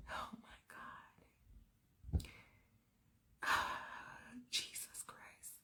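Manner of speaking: whispered, sighing in despair; whispered exasperation from [name]; exasperated whisper. Acted. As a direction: breathe it out in defeat. [name] exasperated under his breath.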